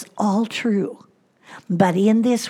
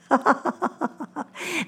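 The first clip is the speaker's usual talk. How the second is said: soft laugh; 6 semitones higher, 5 dB quieter than usual. Real, not acted. Laughs at doctor's instruction.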